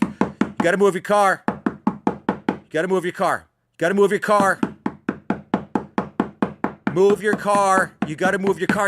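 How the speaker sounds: high, insistent repeated order; guy outside yelling. Retold or imitated. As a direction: keep nagging you to move the car. Stranger knocking, telling you to move car.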